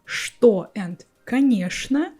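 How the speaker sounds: что as "shto", конечно as "ka-nyesh-na"; "sh" sound in что and конечно. Shown as a demonstration. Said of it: "will be pronounced with sh sound".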